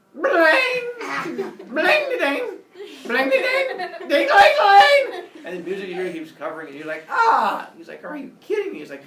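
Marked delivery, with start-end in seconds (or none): 0.1-5.2 very high, loud twanging imitation; piercing mock lyre racket; awful lyre playing. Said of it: "Heracles begins playing". 7.1-7.7 loud, high yelp of agony; hammed-up cry at a horrible noise; mock howl of pain. Teacher reacts to awful playing. 8.0-8.8 high, indignant mock outburst; disbelieving scolding; exasperated teacher. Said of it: "yelling at him".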